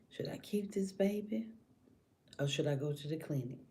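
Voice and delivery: softly voiced private deliberation; hushed inner thought. Woman's inner thought, acted out.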